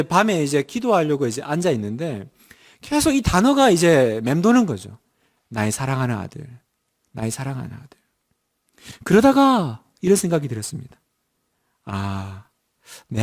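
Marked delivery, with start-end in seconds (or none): 5.5-8.0 quiet, lower, inward repetition; quietly echoing phrase; soft musing. Retold or imitated. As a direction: murmur it to yourself. Words echoing in his mind.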